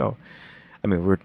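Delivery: ordinary talk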